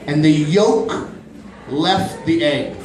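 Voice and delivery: slow suspenseful build on "yolk"; slow dramatic telling. Acted. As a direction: draw it out, slow.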